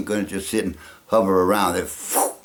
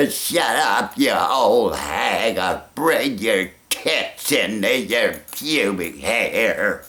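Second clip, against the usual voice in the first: slow, drawn-out, mock-haughty old star snapping; Katharine Hepburn impression; much slower than usual. Continuing the impression, he has Katharine Hepburn insult someone, slow and haughty. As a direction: bark it slowly like an imperious old actress. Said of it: "or maybe like Katharine Hepburn say".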